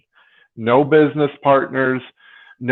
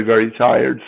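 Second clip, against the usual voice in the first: ordinary talk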